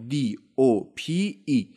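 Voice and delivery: slow letter-by-letter spelling of dope; spelled out slowly. Shown as a demonstration. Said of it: "اسپل کردم دیگه".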